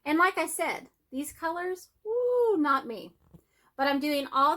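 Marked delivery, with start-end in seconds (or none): 2.0-2.6 high, loud "woo"; whoop. Real, not acted. Crafter reacting to colours that aren't her, high loud whoop. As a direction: let out a drawn-out high whoop.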